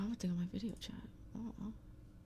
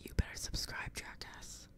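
whisper. Acted. Streamer whispering a mock threat to viewers. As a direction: tease it in a whisper.

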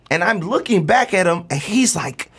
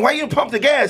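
higher-pitched, puzzled demand; exasperated parent; 7 semitones higher than usual. Retold or imitated. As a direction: ask your son, baffled and pitched up. Parent questioning nervous son.

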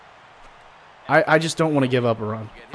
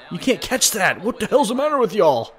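normal talking voice

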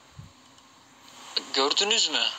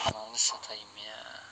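resigned mutter; 9 semitones lower, 11 dB quieter than usual. Real, not acted. Resigned after losing big.

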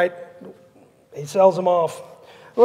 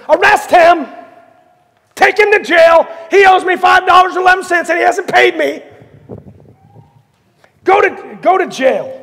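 high, loud enraged shouting; furious orders; 6 semitones higher, 5 dB louder than usual. Acted. Servant to the police officer, furiously shouting. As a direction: yell at the officer to haul him off.

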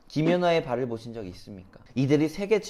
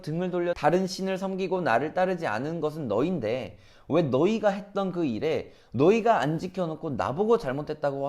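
wronged, indignant retort. Retold or imitated. God answering Israel's accusation of unfairness, indignant retort. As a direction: throw the blame back, aggrieved and insistent. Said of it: "얼마나 억울하셨으면 그러셨겠어요".

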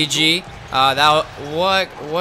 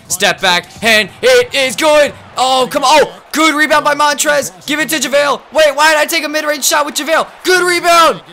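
shouted, much higher commentary calls; hyped play-by-play; 11 semitones higher, 5 dB louder than usual. Real, not acted. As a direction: call the action like a hyped announcer. Yelling live play-by-play.

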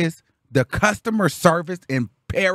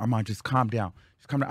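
calming; 5 semitones lower, 6 dB quieter, faster than usual. Retold or imitated. Mama soothing, retold.

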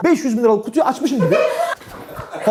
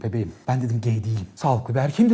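low tender voice; 13 semitones lower, 6 dB quieter than usual. Retold or imitated. Tender explanation to hostess.

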